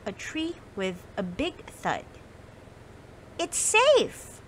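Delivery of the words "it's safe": high, relieved mouse cry; relieved cheer. Acted. Molly cheers in relief that the snowball stopped safely. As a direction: cheer out as if clapping with joy. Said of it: "Molly clapped".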